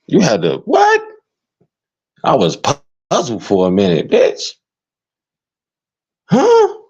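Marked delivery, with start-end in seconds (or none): 0.6-1.0 high squeak of disbelief; squeaky disbelief. Real, not acted. Incredulous reaction. 6.3-6.8 high squeak of disbelief; squeaky disbelief. Real, not acted. Incredulous reaction.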